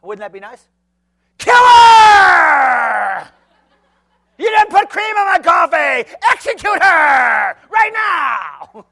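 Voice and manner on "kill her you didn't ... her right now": hammed-up screaming customer, slow at the end; mock furious shout over coffee; irrational rage. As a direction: overreact wildly to missing cream. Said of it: "react like David".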